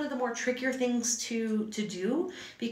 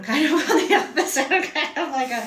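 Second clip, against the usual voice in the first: laughing loudly through the words; 7 semitones higher, 10 dB louder than usual. Real, not acted. Teacher laughs at herself while describing her lettering. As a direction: giggle out a self-deprecating joke. Joking about her own shape.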